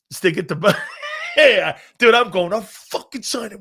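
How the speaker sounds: loud, breaking into laughter; laughing shout. Real, not acted. Player gleefully retelling his spite move to friends, laughing loudly. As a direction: crack up while calling it out.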